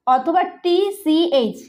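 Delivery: usual speaking voice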